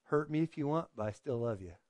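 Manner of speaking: understated, soft declaration of love; tender love. Retold or imitated. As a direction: say it quietly with steady love. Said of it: "to say".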